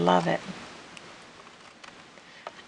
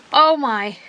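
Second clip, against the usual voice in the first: real startled gasp, higher and louder; 8 semitones higher, 6 dB louder than usual. Real, not acted. Crafter jumps at a mishap at her table, startled. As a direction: gasp and cry out in shock. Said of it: "Oh, that scared me".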